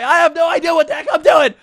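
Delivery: high, loud panicked yell; hammed-up clueless rookie shouting. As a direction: scream it like you're lost on the field. Clueless player panics after the snap.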